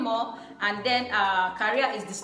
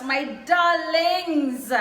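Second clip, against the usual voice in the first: drawn-out fond darlings; 5 semitones higher, 5 dB louder, much slower than usual. Real, not acted. Fond address to returning subscribers.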